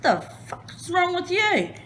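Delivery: high-pitched mock husband, baffled; husband's outburst. Husband baffled as she bounces out of bed, high mock voice. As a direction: squeak out his confusion. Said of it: "Barry's like".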